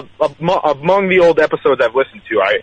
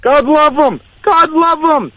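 much higher host catchphrase; host impression; slower than usual. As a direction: deliver it like the host's signature line, pitched way up. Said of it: "You're doing a pretty good impression".

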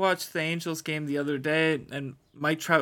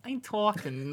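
higher-pitched attempt at a Boston accent; 6 semitones higher than usual. Co-host imitating a ballplayer for the host, Boston accent. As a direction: put on a brief, shaky-confidence Boston accent. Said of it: "just gonna do the Boston accent".

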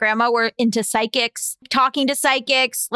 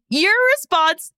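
usual speaking voice

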